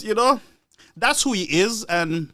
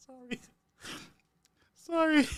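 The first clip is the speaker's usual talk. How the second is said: laughing apology; 6 semitones higher, 11 dB quieter than usual. Real, not acted.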